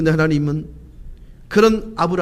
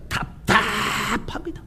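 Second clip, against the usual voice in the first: strained, softer 답답합니다, stretched as if choking on it; exasperated; 6 dB quieter, much slower than usual. Real, not acted. Preacher likens the dialogue to dry sweet potato, exasperated. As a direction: drag 답답합니다 out with a long held second syllable.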